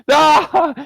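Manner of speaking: sudden shout of realization; a loud, high "Ah!" of realization; realizing shout. Real, not acted.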